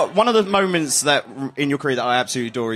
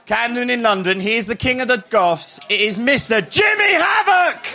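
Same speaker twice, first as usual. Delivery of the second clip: shouted, drawn-out ring announcer call; announcer intro; 8 semitones higher than usual. Acted. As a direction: bellow the name to the crowd. Introduces guest to crowd.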